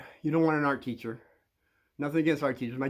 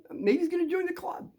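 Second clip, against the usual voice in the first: put-on kids' wondering voices. Bullies wonder to themselves about the new guy, high-voiced musing. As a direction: pitch way up into a squeaky musing kid. Voicing kids' second thoughts.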